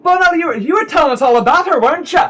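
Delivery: high, loud gushing hostess; effusive woman character. Acted. Game master voices Summer Coroner.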